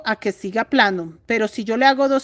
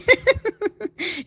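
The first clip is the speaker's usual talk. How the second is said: laugh; 9 semitones higher than usual. Real, not acted. Joke about the enemy.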